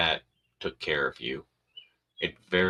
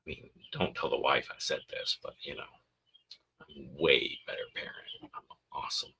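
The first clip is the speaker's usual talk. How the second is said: very soft, half-whispered aside; confidential boast; 3 semitones lower, 6 dB quieter, faster than usual. Acted. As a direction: confide it so the wife won't hear. Secret boast kept from the wife.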